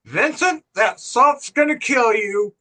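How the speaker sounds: talking around a cigarette; words squeezed past a cigarette in mouth. Retold or imitated. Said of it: "my mom has a cigarette in her mouth".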